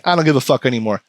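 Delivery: ordinary delivery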